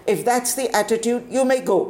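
high, clipped dismissal; cold warden. Acted.

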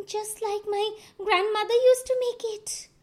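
Anansi's voice. A narrator's reading. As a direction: make excuses like a sly spider. Anansi pretends to like soup.

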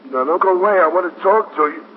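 begging them not to leave him; desperate pleading. Acted. Father pleads with captain.

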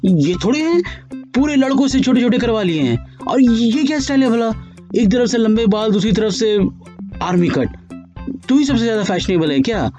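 high, outraged mother; flaring up. Acted. Mother flaring up at her daughter's new haircut, outraged. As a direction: flare up at the short haircut. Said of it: "भड़क गई".

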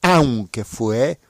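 a lively diary-story read aloud for Spanish learners; story narration. Acted. Teacher reads a graded story to listeners, lively narration. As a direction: tell the trip story clearly and with energy.

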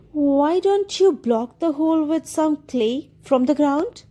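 a high, light cat voice; mewing cat. Acted. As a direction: mew it up like a cat. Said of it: "He mewed".

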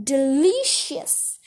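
slow, drawn-out relish; stretched-out gloating delight; savouring relish. A narrator's reading. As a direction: linger on the word as if tasting it. Grandma savours eating live slugs.

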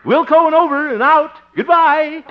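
jaunty military radio lingo, loud and laughing; laughing mock pilot sign-off to Willis.

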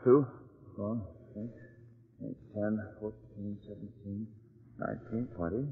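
muttered counting. Acted. Jeff counts the windows while circling the house, under his breath. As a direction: count quietly to yourself.

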